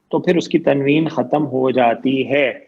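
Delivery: usual speaking voice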